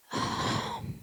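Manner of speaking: soft breathy sigh as the son; shaky sigh. Retold or imitated. Son after the ride, sighing before answering mom, drained sigh. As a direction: let out a drained, tearful sigh.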